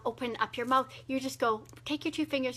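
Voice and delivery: usual speaking voice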